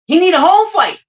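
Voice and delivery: high, loud insistence; emphatic shout. Real, not acted. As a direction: shout it like it's obvious. Insisting Norman needs home fight.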